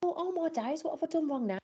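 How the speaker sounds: retold panic at the chanting kids, high and flustered; panicked thought. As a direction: squeak it out in sudden dismay. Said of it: "and I thought".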